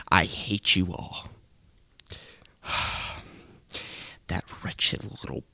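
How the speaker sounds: normal talking voice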